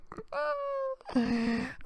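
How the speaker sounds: laughing so hard it squeaks high; high squealing laugh. Real, not acted. Comedian cracking up at his own joke, squealing laugh.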